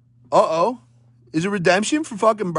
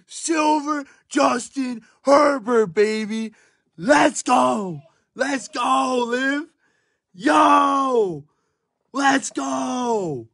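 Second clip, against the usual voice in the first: excited shouting; 6 semitones higher, much slower than usual. Real, not acted. Celebrating the Herbert pull.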